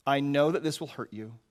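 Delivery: usual speaking voice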